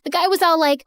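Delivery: ordinary delivery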